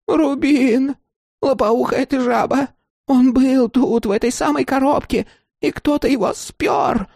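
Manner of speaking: high, furious crone outburst; outraged old woman. Acted. Old woman rages at theft.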